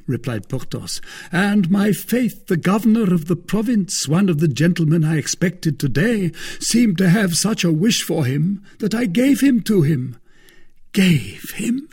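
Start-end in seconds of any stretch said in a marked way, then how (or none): 1.3-10.2 high, expansive storyteller; self-important Porthos. A narrator's reading. Voices Porthos telling of the horse. 10.9-11.9 high, rising question of disbelief; astonished cry. A narrator's reading. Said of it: "cried D'Artagnan".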